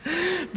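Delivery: quick high chuckle; a brief amused laugh; real short laugh. Real, not acted. Laughs after impression.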